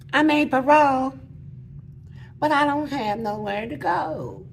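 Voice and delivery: put-on baby voice. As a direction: make the church lady cry. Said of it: "try to talk real soft, like a little baby".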